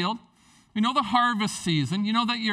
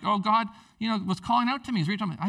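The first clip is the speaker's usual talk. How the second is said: ordinary talk, nothing marked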